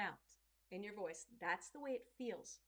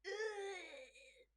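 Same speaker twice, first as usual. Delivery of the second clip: strained grunt; 10 semitones higher than usual. Shown as a demonstration. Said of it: "strain comes in".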